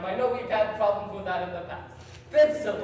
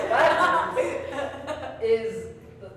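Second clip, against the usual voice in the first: laughing; 5 semitones higher, 3 dB louder, much slower than usual. Real, not acted. Laughing while guessing.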